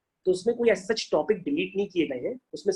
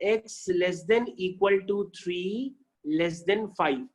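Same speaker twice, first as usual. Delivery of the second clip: very slow, spaced-out reading; teacher dictating slowly; slow reading aloud; much slower than usual. Shown as a demonstration. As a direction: read it out word by word. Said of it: "यहाँ पे लिखा हुआ है".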